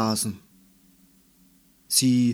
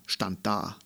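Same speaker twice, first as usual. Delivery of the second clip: usual speaking voice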